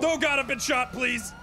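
high, distressed begging; urgent high-pitched wounded plea; pained pleading. Pretend. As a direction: plead like you've been shot. Wounded character begging for help.

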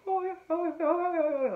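high, loud warbling mock vibrato. Imitating a French singer for a friend, loud high warble. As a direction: warble it like an old French chanteuse. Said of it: "warbly, warbly ass voice".

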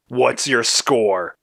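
slow, drawn-out demand; interrogating. Acted. Red grilling Blue about the score, slow and demanding. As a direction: press each word slowly.

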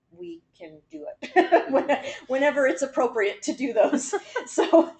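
words broken by laughter; laughing. Real, not acted. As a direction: chuckle through the line. Laughing while giving update.

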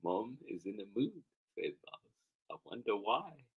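soft reading at normal pitch; understated wondering. Acted. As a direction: wonder it quietly, without performing it. Same remark, second reading.

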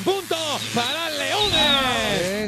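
high, slow drawn-out point call; triumphant announcer shout. Real, not acted. Host awarding Leones the point, drawn-out shout. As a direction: call the score out big and high.

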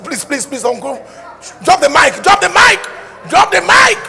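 loud, high begging to stop; mock crowd yelling drop the mic; pleading audience. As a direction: beg the singer to quit, shouting. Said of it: "the people are saying".

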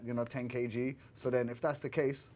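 ordinary delivery

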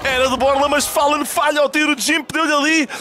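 high-pitched shouting caster call; excited play-by-play. Real, not acted. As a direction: scream the clutch moment. Climax of a round call.

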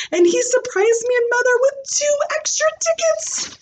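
put-on high, quick little girl chatter; Junie B voice. Junie B explaining the trip to the class, high quick chatter. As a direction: rattle it off like an excited kid.

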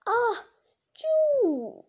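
high-pitched acted "ah-choo"; dragon sneeze. Dragon sneezing over the huddled village, high drawn-out "ah-choo". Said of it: "The sneeze blasted from the dragon".

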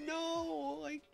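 high, pleading protest; tearful no. Retold or imitated. Player refusing Bob-omb's sacrifice, high pleading voice. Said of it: "And it's like".

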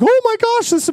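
high shocked gasp of a sample lady; alarmed sample lady. Retold or imitated. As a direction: squeal it out, startled and worried.

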